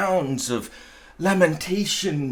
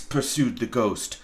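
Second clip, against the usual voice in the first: ordinary delivery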